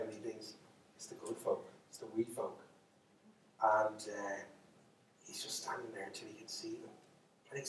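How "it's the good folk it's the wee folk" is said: very soft, half-whispered dread; hushed realization. Acted. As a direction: think it aloud under your breath. Thinks to himself, fairies coming.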